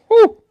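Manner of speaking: short, high "woo"; loud celebratory yip; excited whoop. Real, not acted. Cheering the episode.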